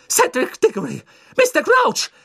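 usual speaking voice

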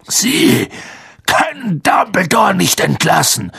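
rough, high-pitched cry of protest; calling out. A narrator's reading. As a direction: burst out hoarsely in outrage. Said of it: "rief Hagrid".

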